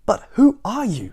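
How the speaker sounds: high, slow, loud question; exasperated demand. A narrator's reading. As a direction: press the question slowly and high. Fussy host questions intruder.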